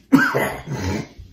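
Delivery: low rough cough; coughing on pepper. Real, not acted.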